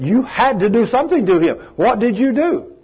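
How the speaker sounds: high-pitched, insistent acted questioning; amazed insistence. Mother pressing Betty about what changed her son, amazed insistence. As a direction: press urgently for the secret.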